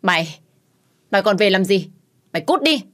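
loud, rising angry yell, peaking high; mother screaming at her daughter to get out; furious shout. A narrator's reading. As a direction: hurl her out of the house. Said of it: "mẹ mới hét lớn".

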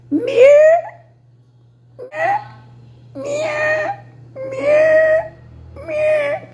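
very high, crying meows; cat meow. Shown as a demonstration. Woman showing how the country cats meow, very high. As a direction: mew like a cat. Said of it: "os gatos miam assim".

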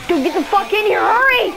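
urgent shout; being chased. Real, not acted. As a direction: yell for them to hurry. Urging friend inside from zombies.

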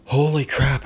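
shouted, gasping for air; scream. A narrator's reading. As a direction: yell it out on surfacing. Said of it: "I screamed".

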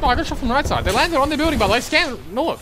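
panicking gamer. Real, not acted. Urgent callout under fire.

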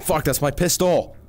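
usual speaking voice

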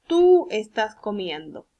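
Tú long and loud, then falling away; stress on Tú. Shown as a demonstration. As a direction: punch Tú and slowly finish the sentence. Teacher models the corrected sentence.

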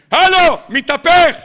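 high, loud, drawn-out mock alarm; prophet calling out. Prophet landing among people, hollered warning. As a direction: holler a slow warning to the crowd.

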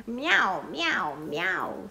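mewing like sparkly cats; cats meowing. A narrator's reading. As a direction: meow in chorus with the witch. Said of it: "say the cats".

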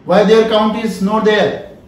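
ordinary talk

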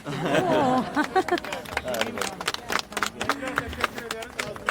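long soft laugh; hearty laugh. Real, not acted. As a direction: laugh it off warmly. Laughs after the last poem.

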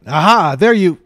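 loud, high gotcha; triumphant shout. A narrator's reading. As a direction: pounce on them with the catch. Catching them in the act.

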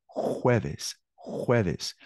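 "jueves" said slowly and clearly, starting on a Spanish h-like j; pronunciation model. Shown as a demonstration. Teacher showing the class how it is pronounced, "jueves" modelled carefully. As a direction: model "jueves" as two clear syllables, HWEH-ves. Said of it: "Se pronuncia así".

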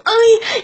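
sharp high "ay" of surprise; startled squeal; high yelp. Retold or imitated. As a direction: yelp it out, caught off guard. Yelping in the taxi.

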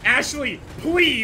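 high, loud exasperated begging; desperate plea. Real, not acted. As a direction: beg the partner to listen. Pleading with companion AI.

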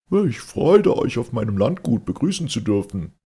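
high, loud overdone welcome; feigned friendliness. A narrator's reading. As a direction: lay on a fake warm greeting. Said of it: "mit gespielter Freundlichkeit".

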